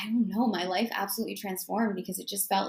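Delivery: ordinary talk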